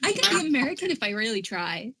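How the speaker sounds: a lightly put-on American accent. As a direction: try hard to sound American. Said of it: "in my American accent".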